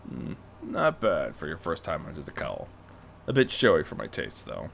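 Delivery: a hint of a low gruff mentor; low, dry older man; lightly voiced Bruce.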